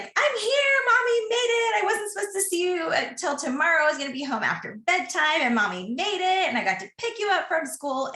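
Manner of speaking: put-on very high, bubbly mom greeting her child; beaming with joy, sing it up high; excited mom. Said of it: "I flew in and I was like".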